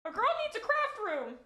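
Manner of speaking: high, loud, playful proclamation; excited declaration. Real, not acted. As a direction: announce it like a big demand.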